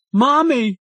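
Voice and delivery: put-on high, scared little boy's cry; a child about to burst into tears; frightened call for mommy. A narrator's reading. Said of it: "The little boy started to cry".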